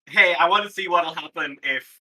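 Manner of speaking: ordinary talk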